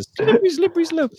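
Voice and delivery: very high squeaky repeat; squeaky joke voice. Acted. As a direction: squeak the punchline.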